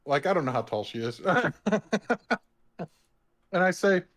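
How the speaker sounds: usual speaking voice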